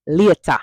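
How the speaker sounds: "later" said as "lieta", loud and falling; Patois goodbye. Shown as a demonstration. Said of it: "You're gonna hear Jamaicans use this a lot".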